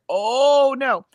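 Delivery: startled no. Retold or imitated. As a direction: blurt it out in alarm. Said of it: "And I was like".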